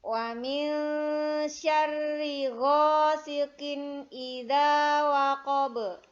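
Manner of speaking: slow, loud melodic chanting; Quran recitation. Shown as a demonstration. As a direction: chant it slowly like murottal. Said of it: "syanya pendek".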